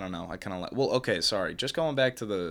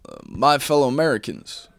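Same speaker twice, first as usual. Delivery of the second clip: put-on Chinese-accented Obama; 2 semitones higher, 5 dB louder than usual. Host demonstrating Chinese Obama to friends, loud fake Chinese accent. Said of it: "something that Obama would say".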